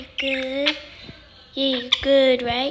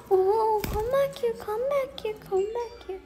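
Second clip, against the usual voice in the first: high sing-song plea, calling a pet back; high coaxing call; 7 semitones higher than usual. Real, not acted. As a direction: coax the bird back in a high voice.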